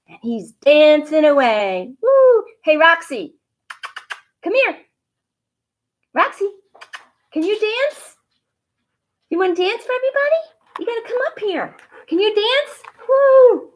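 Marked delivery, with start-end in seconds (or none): 2.0-2.4 high, loud whoop; cheer. Real, not acted. Cheering on the dancing dog, high loud whoop. 2.6-12.8 high coaxing voice to the dog; pet voice. Real, not acted. Calling her dog Roxy to dance, high coaxing pet voice. 13.1-13.7 high, loud whoop; cheer. Real, not acted. Cheering on the dancing dog, high loud whoop.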